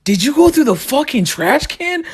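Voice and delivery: high, slow shocked outrage; can't believe mom dug through the trash; a high, slowed, stunned comic squawk; outraged disbelief. Retold or imitated.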